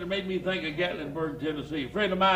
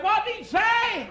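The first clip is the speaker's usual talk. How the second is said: much higher voice for the wife; shrill-free but high nagging question; wife nagging husband in the car, high; 14 semitones higher than usual. Retold or imitated. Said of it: "She said".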